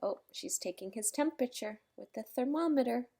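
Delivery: usual speaking voice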